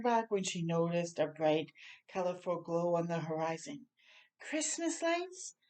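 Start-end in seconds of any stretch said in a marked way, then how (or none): 4.4-5.6 high, slow wondering question; awed wonder. Acted. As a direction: marvel at the lights. Dasher spots glow, wonder.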